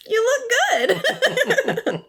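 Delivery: very high, very slow, laughing "You look good"; hammed-up sweet-talk, high and slow; mock flattery. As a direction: stretch the compliment out in a squeaky, giggling voice. Jim flattering Jackie, retold.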